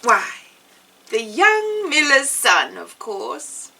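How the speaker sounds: plain reading voice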